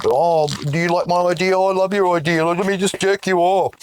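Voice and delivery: high, loud sarcastic fawning voice; mock flattery. Mocking people who praise each other's ideas. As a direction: gush like a suck-up.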